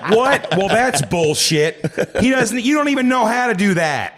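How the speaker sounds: high-pitched mock maternal outrage; outraged mother. Mother refusing to believe her son, outraged. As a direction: protest like an indignant mum, voice way up. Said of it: "And she'll be like".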